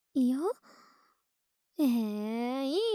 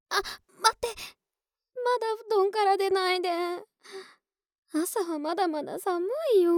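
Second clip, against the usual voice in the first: higher and louder, hurried; urgent plea; 7 semitones higher, 5 dB louder than usual. Acted. Girlfriend urgently stopping her partner from leaving the warm futon. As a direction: grab them before they get out of bed.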